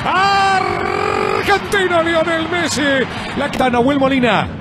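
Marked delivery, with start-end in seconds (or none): 0.1-2.8 long drawn-out goal call; goal scream. Real, not acted. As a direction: hold the goal shout long.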